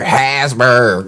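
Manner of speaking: low-pitched mimicry of "Hasberg"; mocking mispronunciation. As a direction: drop low on "Hasberg", H and Z intact. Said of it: "that shit get me every time".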